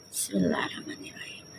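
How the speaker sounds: a low private whisper, almost no voice; whispered prayer. Real, not acted. Reader whispers the bismillah before starting, whispered.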